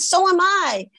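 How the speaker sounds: high, eager chime-in; retold girlish enthusiasm; perky echo. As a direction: pipe up with delighted coincidence. Acts the other girl's excited reply.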